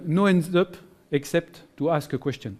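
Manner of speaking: slow English with a French accent; careful, French-accented English, phrase by phrase. Real, not acted. Teacher quoting an education researcher to trainees, slow French-accented English. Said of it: "avec mon superbe accent".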